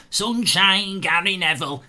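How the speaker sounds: hammed-up Manchester accent; Gary Neville impression. Streamer calls out to chat in a Manchester accent. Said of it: "I can't do the accent".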